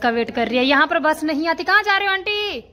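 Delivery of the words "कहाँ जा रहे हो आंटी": calling out, higher and louder. Real, not acted. Calling to the aunty waiting for a bus, loud. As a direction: call across the road to her.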